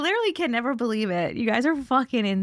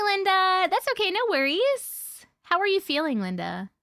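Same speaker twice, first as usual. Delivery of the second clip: usual speaking voice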